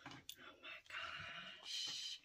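very slow, drawn-out whisper; hushed, stretched-out awe; awed whisper. Real, not acted. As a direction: whisper it in amazement. Awed by the earrings.

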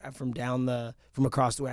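ordinary talk, nothing marked

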